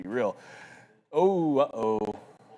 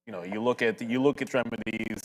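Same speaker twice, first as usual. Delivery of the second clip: ordinary delivery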